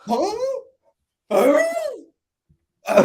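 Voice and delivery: exaggerated, high questioning huhs with long gaps; mock bewildered grunts, one at a time; mocking imitation. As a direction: mimic a clueless, dumbfounded man. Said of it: "that was his face".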